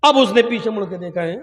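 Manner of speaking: ordinary delivery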